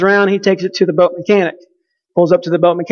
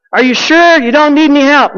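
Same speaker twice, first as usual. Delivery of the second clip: mock call, pitched higher; calling across water; 6 semitones higher than usual. Boater offers dad help across the lake, mock call.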